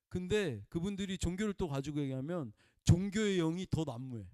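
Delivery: usual speaking voice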